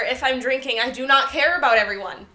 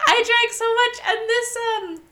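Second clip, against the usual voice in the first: pitched up while laughing through the words; 10 semitones higher than usual. Real, not acted. Laughing at own drunk story.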